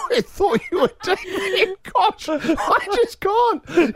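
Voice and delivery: high-pitched laughing, slowed down. Real, not acted. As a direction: squeak through laughter. Laughing at his own blunder.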